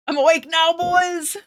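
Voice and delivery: rattled, keyed-up shout-out; jolted awake. Real, not acted. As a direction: call it out, newly wide awake. Jolted awake by loud alerts.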